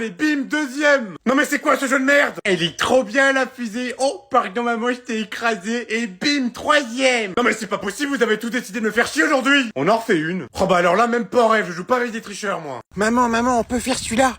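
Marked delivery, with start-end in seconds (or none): 0.0-1.2 gloating. Acted. Kid gloats overtaking mother. 1.3-2.4 raging gamer. Acted. Mother loses the kart race. 4.0-7.3 gloating. Acted. Kid gloats again. 7.4-9.7 high, angry outburst; furious at being crushed again; raging gamer. Acted. Mother rages after losing again.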